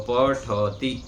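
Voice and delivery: पठती said very slowly with a full vowel after ठ; slow teacher model of पठती; correct pronunciation. Shown as a demonstration. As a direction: stretch out पठती, pa-tha-ti, nothing swallowed. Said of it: "पूरा वर्ण है उसको ऐसे ही बोलना है".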